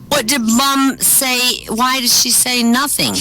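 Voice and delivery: put-on high, slow little-boy voice; puzzled young son. Son asking his father about Mom, puzzled. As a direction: ask it like a baffled child.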